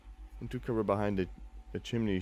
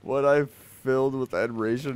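louder, higher, amused disbelief; raised voice, fresh from laughing; incredulous repeat; 4 semitones higher, 6 dB louder than usual. Real, not acted. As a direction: quote it back as if it can't be true.

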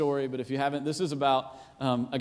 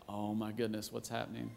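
under your breath; 5 semitones lower, 15 dB quieter than usual. Retold or imitated. Bystander reacting to public yelling.

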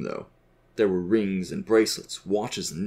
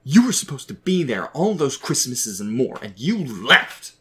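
loud, raised-pitch accusation; exploding in anger; 5 semitones higher, 5 dB louder, slower than usual. A narrator's reading. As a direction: let it burst out, dragging out the final blame. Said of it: "exploding at his father".

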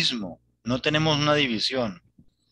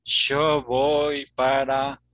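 slow Rioplatense demo with yo as sho; Argentine accent; much slower than usual. Shown as a demonstration. Teacher slowly demonstrating Argentine speech to a student. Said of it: "Yo, así yo".

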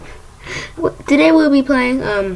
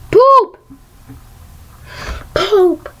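yelled; 7 semitones higher than usual. Real, not acted. Yelling a player's silly name.

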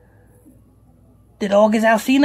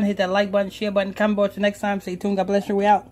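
lower, fast host outro; radio sign-off; 5 semitones lower, much faster than usual. Acted. Host thanking listeners before signing off, as a lower, fast radio outro.

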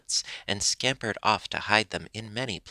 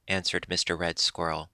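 plain reading voice

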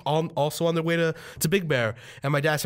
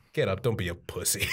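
low, quiet unimpressed dad; mock gruff dad; 8 semitones lower, 5 dB quieter than usual. Dad standing over crashed son, low dismissive order. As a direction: drop low and soft, no sympathy.

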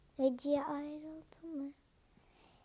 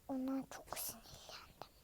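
whispered annoyance; hiss-free breathy confession; 13 dB quieter than usual. Real, not acted. Whispering to viewers how annoyed she is.

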